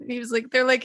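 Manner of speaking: ordinary talk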